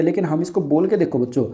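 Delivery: normal talking voice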